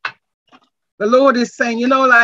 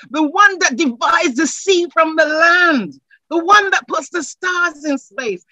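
full-force preaching; 4 semitones higher than usual. Acted. Pastor lifting the congregation, full-force rousing preaching.

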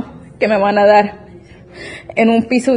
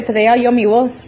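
ordinary delivery

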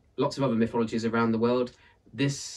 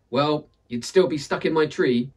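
louder, higher retort; sniping; 6 semitones higher, 8 dB louder than usual. Acted. Aunt Misery sniping back at Death, louder retort. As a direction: fire back a sharp jab. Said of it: "she sniped back".

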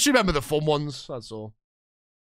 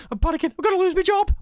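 high, louder panicked cry; rising panic about the sack; panicking; 4 dB louder, faster than usual. Real, not acted. Manager fearing the sack, panicking out loud. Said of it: "I'm nervous".

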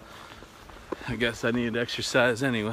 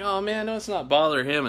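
reluctant refusal; 7 semitones higher than usual. Retold or imitated. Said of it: "And I'm like".

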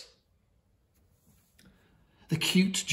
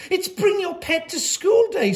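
high, louder flustered protest; spluttered; 6 dB louder than usual. A narrator's reading. Teacher defending pet day to the headmistress, flustered splutter. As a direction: sputter it out in a fluster. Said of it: "spluttered Mister Bright".

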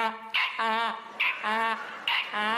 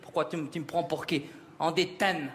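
lower-pitched dad voice; imitated father; 6 semitones lower, 3 dB quieter than usual. Son asks dad for English, father answers in low imitated voice. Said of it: "Papa, comment on dit dix en anglais?".

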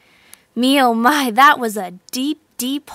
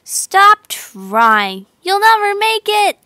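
yelled; 6 semitones higher, 4 dB louder, slower than usual. A narrator's reading. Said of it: "yelled the frogs at the top".

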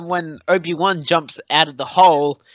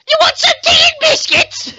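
high mock-posh English voice, loud; squeaky hammed-up British accent; mock British accent offering tea; posh British assassin; 5 dB louder than usual. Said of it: "the last thing I will ever hear is".

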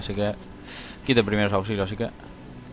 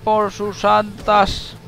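mock yell; 9 semitones higher, 8 dB louder, much slower than usual. Real, not acted. Mock rallying cry at game.

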